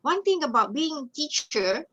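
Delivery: ordinary talk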